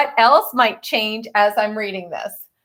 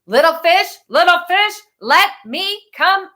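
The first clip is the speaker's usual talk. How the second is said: shark calling; 8 semitones higher than usual. A narrator's reading. Shark at the sand house door, sing-song and higher. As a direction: coax them out like a sly shark. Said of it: "roared the shark".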